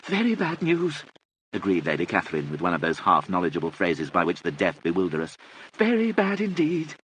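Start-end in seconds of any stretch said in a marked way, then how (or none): 0.0-1.0 very high-pitched aristocratic lady; deaf old lady. A narrator's reading. 5.8-7.0 high, slow agreement; half-understanding deaf aristocrat, pitched up; deaf old lady. A narrator's reading.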